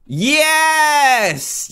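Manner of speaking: long, high, excited yes; elated high cheer; triumphant shout. Real, not acted. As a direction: let out a drawn-out victory cry. Celebrates a landed jump.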